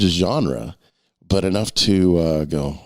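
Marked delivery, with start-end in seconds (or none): none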